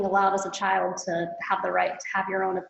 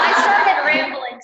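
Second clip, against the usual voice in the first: laughing while admitting it, higher and louder; 6 semitones higher, 9 dB louder, slower than usual. Real, not acted. Speaker laughs at her own rambling. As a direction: giggle through the confession.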